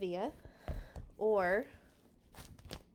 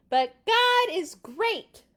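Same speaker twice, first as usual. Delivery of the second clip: much higher, loud defiant shout; 11 semitones higher, 10 dB louder, slower than usual. Acted. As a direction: yell it up at the giant. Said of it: "David shouted up at him".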